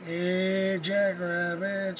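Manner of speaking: usual speaking voice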